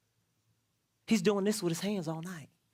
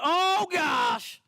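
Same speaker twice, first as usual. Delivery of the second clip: man crying out to God when the paycheck fails; panicked outcry; 7 semitones higher than usual. Retold or imitated. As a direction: cry out like everything is falling apart. Panicked cry when life crumbles.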